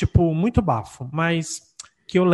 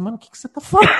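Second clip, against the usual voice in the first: higher, puzzled retort; confused boyfriend; 5 semitones higher, 5 dB louder, much faster than usual. Retold or imitated. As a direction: imitate a baffled American guy. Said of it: "um menino ... falava assim". (imitando o namorado confuso)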